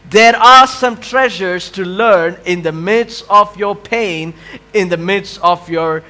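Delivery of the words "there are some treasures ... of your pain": ordinary delivery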